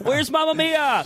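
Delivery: very high, drawn-out mock holler; imitated heckler's yell. Imitating someone yelling at the comic, high mock yell. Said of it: "Somebody yelled that at me".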